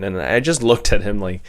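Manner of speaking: ordinary talk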